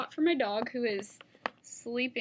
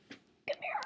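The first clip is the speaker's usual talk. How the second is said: soft high whisper; coaxing whisper to dog; 6 dB quieter than usual. Real, not acted. Vlogger coaxing her dog Molly to the camera in a whisper. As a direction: coax the dog in close.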